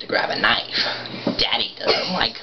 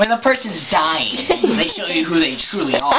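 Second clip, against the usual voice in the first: Joker; 7 semitones higher than usual. Acted. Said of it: "do your, uh, Joker impression".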